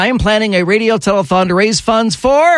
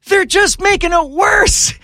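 much higher, near shout of exasperation; exasperated outburst; 14 semitones higher than usual. Real, not acted. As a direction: let the frustration burst out. Rant peaks at reply-all chain.

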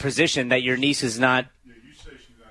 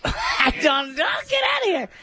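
high shout through laughter; laughing shout; 13 semitones higher than usual. Real, not acted. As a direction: yell it, cracking up.

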